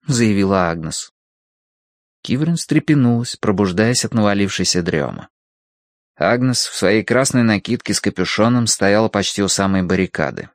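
ordinary delivery

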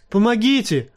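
high-pitched, restrained narrated cry; cry for help. A narrator's reading. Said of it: "закричал Бран". (сдержанный высокий крик о помощи)

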